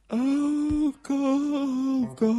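high, loud drawn-out wail; hammed-up sobbing call, voice pushed high; mock crying. A narrator's reading. American girlfriend at the airport goodbye, wailing tearfully. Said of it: "huilde ze".

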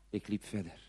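ordinary delivery

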